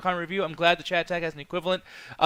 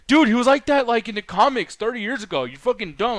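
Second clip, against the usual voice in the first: high, loud imitation of an irate viewer; pitched-up scolding, hammed-up; mock angry yelling; 6 semitones higher, 5 dB louder than usual. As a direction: mimic an indignant know-it-all, easing off. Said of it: "be fucking yelling at me".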